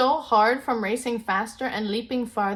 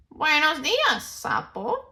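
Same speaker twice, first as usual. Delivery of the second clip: higher, louder chorus greeting; cheerful friends; 8 semitones higher, 4 dB louder, slower than usual. Acted. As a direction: sing out hello together. Friends greet toad back.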